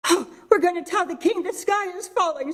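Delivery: a silly hen giggling her news; Henny Penny. Acted. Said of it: "said Henny Penny".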